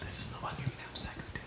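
soft whispered tease; hushed mock-ASMR promo. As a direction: whisper it close to the mic. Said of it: "as an A S M R episode".

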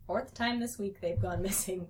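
ordinary delivery, nothing marked

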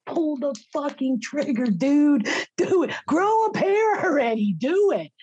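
very high, loud daring yell; taunting scream. Retold or imitated. Wife dares husband to pull trigger, high taunting scream. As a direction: goad him at the top of your voice. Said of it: "And I'm screaming at him".

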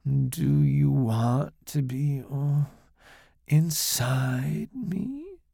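very slow seductive purr. Acted. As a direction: draw every word out to lure him. Seductress tempting.

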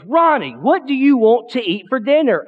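retold yell right in someone's face, not full force; mock yelling. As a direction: act out grandma hollering at grandpa. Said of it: "screaming at the top of her lungs".

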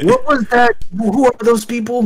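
sudden high, loud disbelief; surprised. Real, not acted. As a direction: blurt it out, caught off guard. Sudden strange scene on screen.

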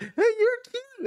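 slow, very high mock flirt; high-pitched catcall. Passenger teasing people at a stoplight, high catcall. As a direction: call out in a drawn-out squeal.

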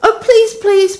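put-on a girl begging not to be split up; Lucy begging the lord, high and pleading. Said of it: "broke in Lucy".

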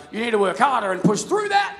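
a hint of God the coach; God urging. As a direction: push them on like a coach. Voicing God's answer.

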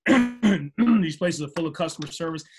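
usual speaking voice

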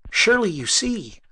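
high, loud urgent plea; pleading. Acted. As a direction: beg him to see it. Inventor pleads again.

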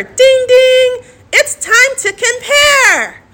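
high, slow game show host call; game show announcer. Pretend. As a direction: ring it in like a quiz host.

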